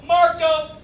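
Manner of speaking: high sing-out call; kid yelling. Retold or imitated. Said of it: "I heard one kid yell".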